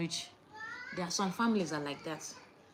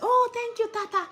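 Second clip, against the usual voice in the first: put-on much higher, louder sweet child voice; a delighted little girl thanking her dad; grateful child; 14 semitones higher, 5 dB louder than usual. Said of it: "Majesty says".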